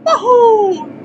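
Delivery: high, loud happy whoop; cheer. Acted. Celebrating the new friends joining, high loud whoop. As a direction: throw out a big high cheer. Cheer after agreeing to join.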